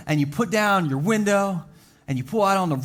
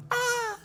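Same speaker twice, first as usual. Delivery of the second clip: yelp of pain; 7 dB quieter than usual. Retold or imitated. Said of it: "and you go".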